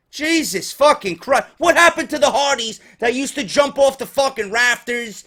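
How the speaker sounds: usual speaking voice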